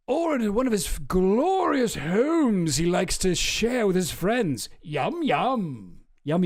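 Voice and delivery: drawn-out, theatrical upper-class English read; mock posh British voice. Streamer reading the birthday book in a smug posh British accent. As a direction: savor each word like a smug old wizard. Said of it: "I sound like Merlin".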